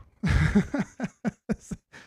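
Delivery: a burst of real laughter; quick, genuine laugh; short laugh. Real, not acted. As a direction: laugh out at the comment. Laughs at chat comment.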